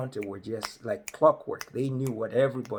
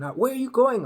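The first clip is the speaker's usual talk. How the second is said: nosy local; 11 semitones higher, 6 dB louder than usual. Retold or imitated. Local quizzing the newcomer.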